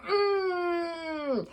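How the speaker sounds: high, long squeezed "mmm"; drawn-out affectionate squeal; hugging hum. A narrator's reading. Acts out a big hug.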